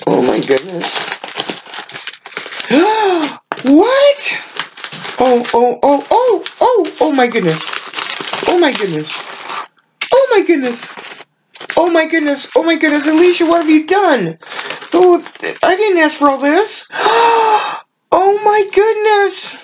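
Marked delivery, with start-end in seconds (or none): 1.2-3.6 long, high, soft indrawn gasp; breathy rising gasp of amazement; astonished gasp. Real, not acted. Opening a surprise in the package. 3.6-4.4 stunned surprise. Real, not acted. Sees an unexpected gift. 5.2-19.6 much higher, breathless repeated exclamations; can't contain the joy of a friend's gift; high, gushing, overwhelmed squeals; overwhelmed excitement. Real, not acted. Overwhelmed opening friend's gifts.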